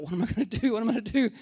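mildly acted panic, rushing into the question; a touch of mock panic; freaking out. Man in gorilla costume as the lion approaches, mild panic. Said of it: "he's, like, freaking out".

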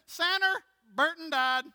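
high, loud Southern grandma call; mamaw's voice. Retold or imitated. Mamaw announcing a death on the phone, high and loud. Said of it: "my Mamaw's voice saying".

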